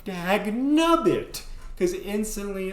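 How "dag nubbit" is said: slow, loud, high cartoon grumble; hammed-up frustrated exclamation; mock curse. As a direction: drawl it out like an old prospector. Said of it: "And I went".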